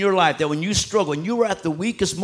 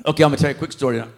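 usual speaking voice

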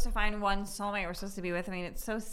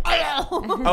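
mock accent gasp; 7 semitones higher, 7 dB louder than usual. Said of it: "You're like".

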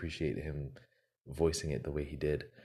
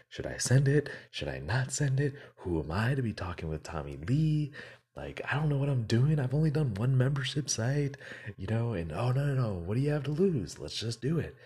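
high, louder acted-out inner argument; agonized self-debate; 7 semitones higher, 5 dB louder than usual. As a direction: act out frantic second-guessing, voice pitched up. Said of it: "had this internal battle with himself".

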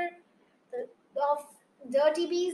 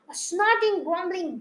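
plain reading voice

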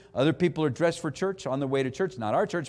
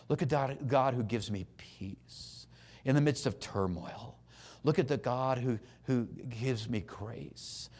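slow, soft, worshipful delivery; hushed reverence; 5 semitones lower, 9 dB quieter, slower than usual. Real, not acted. Preacher softly praising God who gives peace. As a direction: let each line sink in softly.

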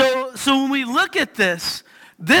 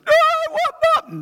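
high, whiny demand for Mom; a daughter refusing her dad, high; whiny child; 3 dB louder than usual. Retold or imitated. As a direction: whine like a kid wanting Mom.

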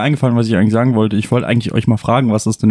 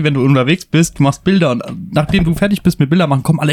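usual speaking voice

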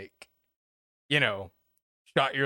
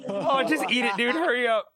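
excited; 9 semitones higher than usual. Real, not acted. Urging friend to eat fish.

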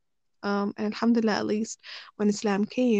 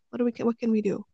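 ordinary talk, nothing marked

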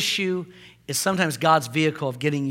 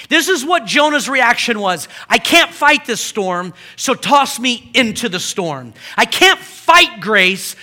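higher, loud pleading Jonah; Jonah's surrender; 8 semitones higher, 10 dB louder than usual. Retold or imitated. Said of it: "it was Jonah's idea".

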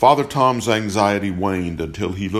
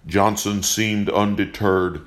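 ordinary delivery

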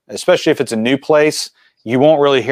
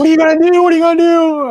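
high, loud panicked woman's voice; shrill worried wife voice; 4 dB louder, faster than usual. Retold or imitated. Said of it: "she's like".

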